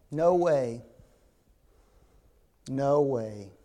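low, deadpan refusal; firm refusal. Acted. Woken father refuses his neighbor bread, low firm refusal. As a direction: drop the voice and shut it down.

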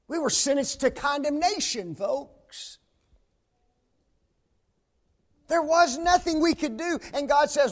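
loud, high-pitched revival preaching; preaching at full force. Acted. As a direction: lift the room with it. Preaching climax.

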